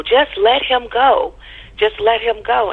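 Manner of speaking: half-acted shout of a stranger in the street; retold yell. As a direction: call it after her, slow and pressing. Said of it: "she turned to me and yelled".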